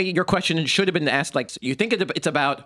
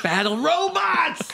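high, slow excited exclaim; 12 semitones higher, much slower than usual. Real, not acted. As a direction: cheer it, stretched out. Echoing a fun suggestion.

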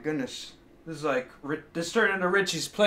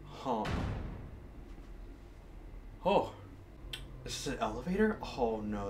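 hushed and nervous; 10 semitones lower, 12 dB quieter than usual. Real, not acted. Unnerved in cramped elevator.

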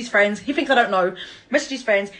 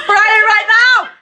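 loud, high, drawn-out jeer; taunting shout; 10 semitones higher, 10 dB louder than usual. Real, not acted. Taunting ex in angry video.

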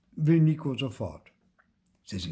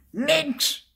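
very high, loud yell; child's shouted one-word rebuff; furious bellow; 5 dB louder than usual. A narrator's reading. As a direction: bellow it back through the door. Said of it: "brüllt Theo".

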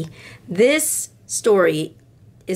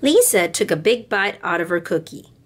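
gentle storybook read-aloud with voices; storybook reading for little girls; reading to children; 4 semitones higher than usual. A narrator's reading. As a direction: read it like bedtime story time. Reads picture book to girls.

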